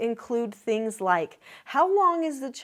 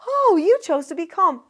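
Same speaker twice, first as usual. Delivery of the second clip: put-on higher, louder bright praise to a child; cheerful praise; 6 semitones higher, 8 dB louder than usual. Mom praising her calm child, cheerful and louder. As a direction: sound delighted the child chose calm.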